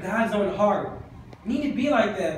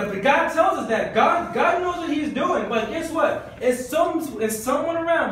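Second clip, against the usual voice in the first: raised, urgent preacher voice; fervent preaching; 6 semitones higher than usual. Acted. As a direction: drive the point home from the pulpit. Preaching, raising pitch.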